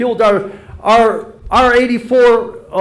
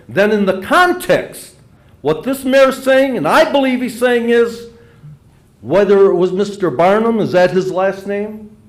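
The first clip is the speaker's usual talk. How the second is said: ordinary delivery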